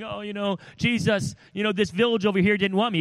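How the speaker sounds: high, fast whiny complaint; mock whiny disciple; mock whining. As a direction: whine like a sulking disciple to Jesus. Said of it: "whining and coming back to me".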